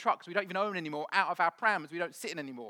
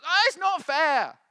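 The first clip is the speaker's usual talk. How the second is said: very high, loud, drawn-out mock whine; whining complaint; 11 semitones higher, 11 dB louder than usual. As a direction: wail it slowly like a sulking child. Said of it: "and he complains".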